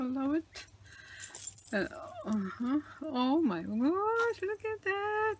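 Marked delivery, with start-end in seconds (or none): 3.0-5.3 awed gasp. Real, not acted. Awed by journal page.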